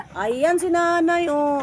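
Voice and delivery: high, loud, drawn-out call; excited sighting. Real, not acted. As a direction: call out that you've spotted her. Spots the old woman, calls out.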